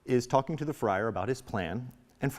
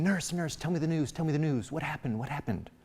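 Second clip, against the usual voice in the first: put-on higher, repeated girlish pestering; impatient; 5 semitones higher than usual. Juliet pressing the nurse for news, impatient. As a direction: pester eagerly in a raised pitch. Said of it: "Juliet is very impatient".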